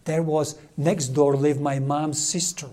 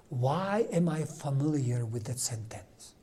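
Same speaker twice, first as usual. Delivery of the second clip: slow, hushed whisper of dawning recognition; whispered wonder; 8 dB quieter than usual. Retold or imitated. A priest whispering in amazement as a memory surfaces. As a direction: breathe it out slowly, barely voiced. Sudden inner realization.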